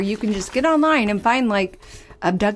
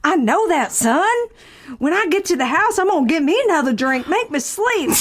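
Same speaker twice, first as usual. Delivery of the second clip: high-pitched hammed-up Southern belle; Southern belle accent; 5 semitones higher than usual. Improvising an abduction witness with a co-host, Southern accent. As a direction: play an astonished Southern witness. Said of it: "our Southern Belle accents".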